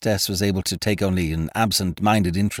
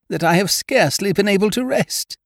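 high, fretful hostess; worried lady; 13 semitones higher, 3 dB louder than usual. A narrator's reading. Hostess telling Ukridge of sleepless worry, high and fretful. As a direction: lament it in a high lady's voice.

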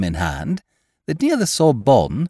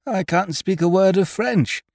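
higher-pitched plaintive dimwit; hapless, muddled chap; 7 semitones higher than usual. A narrator's reading. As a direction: recount your woes as a helpless silly friend. Biffy voiced by narrator.

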